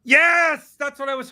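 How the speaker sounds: high, loud cheer of triumph; excited cheer. Real, not acted. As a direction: celebrate the win. Hoped-for snack drawn.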